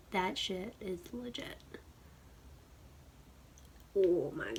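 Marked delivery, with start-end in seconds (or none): 0.1-1.6 whisper. Real, not acted. Hushed verdict on swatch.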